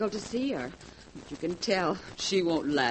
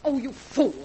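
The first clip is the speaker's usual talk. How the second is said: higher and louder, a sharp scolding cry; angry rebuke; 6 semitones higher, 5 dB louder than usual. Acted. Wife scolding her drunk husband Gerald, angry rebuke. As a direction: lash out at him for drinking.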